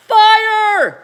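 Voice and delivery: high, loud screech; yelled. A narrator's reading. Old woman yells fire at George, loud screech. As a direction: shriek the single word in alarm. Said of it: "the old woman yelled".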